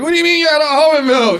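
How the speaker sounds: very high, loud yappy little dog; squeaky dog voice. Retold or imitated. Comic voicing armpit dog echoing almond milk complaint. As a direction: squeal it like a squashed tiny dog. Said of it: "And the dog's like".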